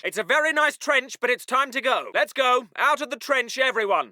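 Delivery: low, gentle officer; mild coaxing. Acted. Officer coaxing chaps from a nice trench, mild. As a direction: coax them out politely. Calm version of trench order.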